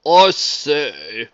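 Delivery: loud refined exclamation; exclaiming gentleman. A narrator's reading. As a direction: exclaim like a dapper gent. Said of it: "Mister Marmalade exclaimed".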